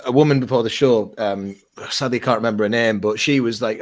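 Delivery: usual speaking voice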